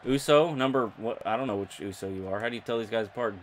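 usual speaking voice

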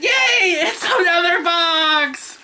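high-pitched mock-celebratory yell, drawn out; excited yell. Reacting to yet another box, excited yell. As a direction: shout it slowly in over-the-top delight. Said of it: "Amy's heard me yell".

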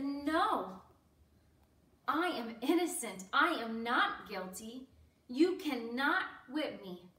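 loud, emphatic protest; forceful denial with punched words; defiant refusal. Acted. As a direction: stand your ground, loudly and firmly. Imagined defiant refusal by Jesus.

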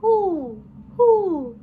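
high, loud hooting owl. A narrator's reading. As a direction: call out like an owl in the dusk. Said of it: "the owl flapped its wings".

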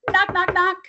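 high loud knock knock knock; knocking sound. Acted. Storyteller voicing a knock at the door, high and loud. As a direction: rap out the knocks brightly.

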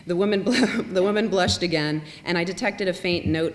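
breaking into a laugh while reading; giggling through a fumbled word. Real, not acted.

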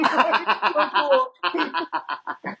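high, laughing "so cool"; laughing with pride. Real, not acted. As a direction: squeal it with proud delight. Proud of son not snitching.

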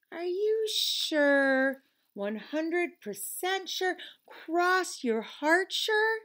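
slow, high, drawn-out doubting questions; slow probing. A narrator's reading. Conscience questioning Leon, slow and suspicious. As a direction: stretch each question to make him squirm.

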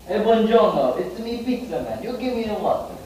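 high-pitched mock Italian pizza man; hammed-up Italian accent.

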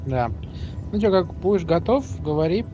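ordinary delivery

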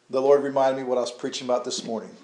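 usual speaking voice

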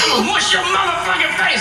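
high, fast mock threat; hammed-up frantic convict voice; prisoner's threat.

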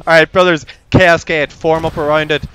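loud command. Real, not acted. Commanding squad in battle.

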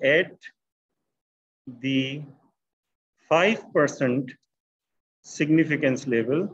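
word by word, long pauses between phrases; slow dictation. Real, not acted. Teacher writing out the interpretation for students, slow dictation. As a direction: dictate slowly as you write it out.

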